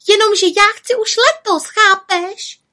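high, loud, insistent cry; little witch yelling. A narrator's reading. Little witch demanding to go this year, yelling. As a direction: shout it in childish protest. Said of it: "křičela malá čarodějnice".

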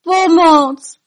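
high, loud frightened shout; cry for help. A narrator's reading. Elves seeing the dragon approach, frightened shout.

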